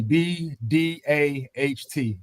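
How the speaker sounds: B, D, A, H, T dieja pelan satu per satu; spelled out. Shown as a demonstration. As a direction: spell each letter slowly. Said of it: "It's not B the hat".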